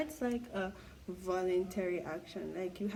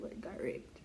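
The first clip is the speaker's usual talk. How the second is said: whispered word; 6 dB quieter than usual. Real, not acted. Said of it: "demonetize me for saying it".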